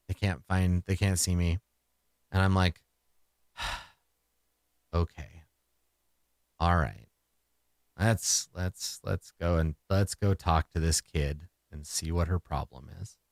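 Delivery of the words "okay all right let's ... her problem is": low, soft, resigned under the breath; weary sigh, quiet. Acted. Steeling himself to talk to the crying kid, low and resigned.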